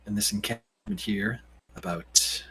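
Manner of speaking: low, quiet, hesitant answer; in-character reply. Acted. Game master answers as Jonathan.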